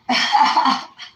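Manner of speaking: loud burst of laughter. Real, not acted. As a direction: laugh out loud mid-story. Laughs at the story.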